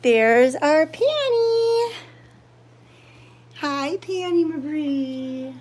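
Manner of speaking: high, slow cooing at a cat; pet talk. Real, not acted. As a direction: coo up high to the kitty.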